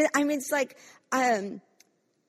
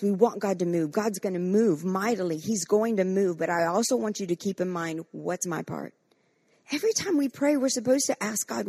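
usual speaking voice

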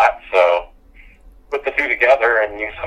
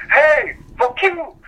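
mock shout; much slower than usual. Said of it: "he went off and was like".

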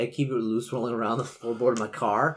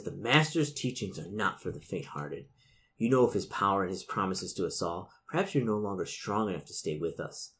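ordinary talk

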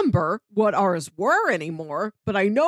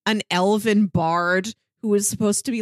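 usual speaking voice

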